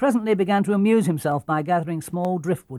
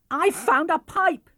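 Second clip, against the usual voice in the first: high, slow, thrilled cry; excited shout; 13 semitones higher, 4 dB louder, slower than usual. A narrator's reading. Girl shouts to her brother about the found pipe, high and loud. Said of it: "she shouted".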